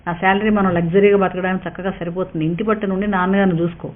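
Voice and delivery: ordinary delivery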